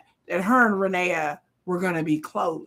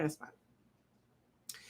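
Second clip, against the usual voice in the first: whispered aside; 6 semitones lower, 19 dB quieter, much slower than usual. Pretend. Whispered repeat of her line.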